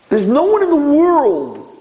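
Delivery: high, slow emphatic declaration; emphatic insistence. Real, not acted. Rabbi defending whoever cleans the soiled man, high drawn-out insistence.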